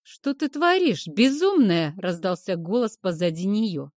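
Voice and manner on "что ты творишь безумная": sharp, high, angry demand; angry outburst. Acted. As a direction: scold her in shock. Yena angrily confronts Kim.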